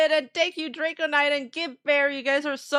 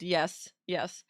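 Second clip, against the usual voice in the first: ordinary talk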